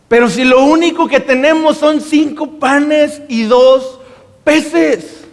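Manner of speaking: stunned, pleading that there is so little food; desperate disbelief. Retold or imitated. Disciples to Jesus, desperate and thrown off. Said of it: "lo dicen con cierta desesperación, sacados de onda".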